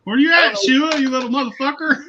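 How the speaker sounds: loud, high-pitched mock challenge; mock taunting call. Imitating a man hunting down his buddy, taunting call. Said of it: "This is what he said".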